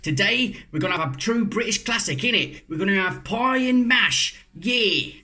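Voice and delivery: hammed-up London Cockney, high and bright; mock Cockney accent. Vlogger greeting viewers in a put-on Cockney accent. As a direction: play the cheeky Cockney host. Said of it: "I'm gonna drop the accent now".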